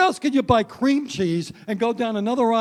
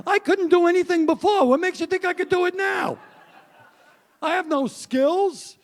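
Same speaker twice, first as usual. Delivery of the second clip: exasperated retort; 9 semitones higher, 3 dB louder than usual. Retold or imitated. Retorting to wife's chores.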